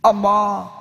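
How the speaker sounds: ordinary talk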